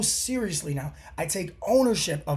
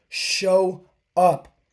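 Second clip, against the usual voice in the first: emphatic shout; 3 semitones higher, 7 dB louder than usual. Real, not acted. Motivational peak, urging listeners.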